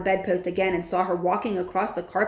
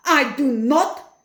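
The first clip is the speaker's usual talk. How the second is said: slow, louder, higher curt witch; 6 semitones higher, 7 dB louder, much slower than usual. A narrator's reading. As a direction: spit it out coldly and slowly. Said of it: "said the Grand High Witch".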